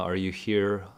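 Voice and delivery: normal talking voice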